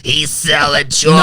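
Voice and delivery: lightly put-on character voice; mock raspy character. Echoing the chocolate-selling bit to friends, mock raspy voice. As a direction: imitate a raspy cartoonish old voice.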